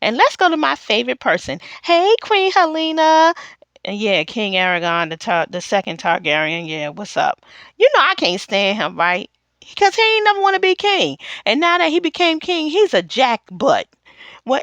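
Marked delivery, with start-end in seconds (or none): none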